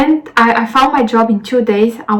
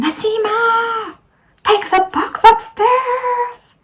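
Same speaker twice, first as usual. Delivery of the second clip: high, drawn-out imitation of a screaming boss; mock scream; 12 semitones higher, much slower than usual. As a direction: mimic the manager's shrill call without full volume. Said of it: "She was screaming".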